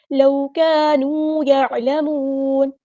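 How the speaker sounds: slow melodic chant, drawn out; Quran recitation. Shown as a demonstration. Teacher models the verse for students, chanting. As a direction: intone the verse like a qari.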